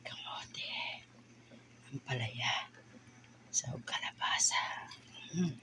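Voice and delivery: hushed, to herself; whispered aside. Real, not acted. Cook whispering vegetable names off-mic. Murmuring after the video ends.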